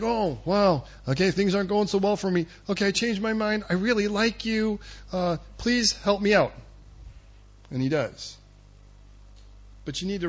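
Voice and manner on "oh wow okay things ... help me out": high-pitched mock-meek pleading; sheepish backpedaling. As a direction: play a king nervously changing his tune. King backs down in retelling.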